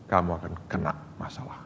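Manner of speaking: whispered, fast warning; a quick whispered caution; hushed warning. Retold or imitated. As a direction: lean in and breathe the threat. Stern private warning to friend.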